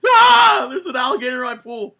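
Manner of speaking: very high, loud shrieking scream; scream like a little girl. Retold or imitated. Host imagining an alligator in his pool, screaming high. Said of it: "I'd still scream like a little girl".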